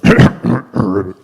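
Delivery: chief frog's pointed throat-clearing; acted throat-clearing. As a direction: clear your throat in character before speaking. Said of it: "cleared its throat".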